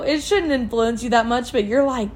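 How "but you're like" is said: ordinary talk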